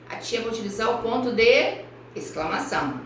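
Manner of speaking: normal talking voice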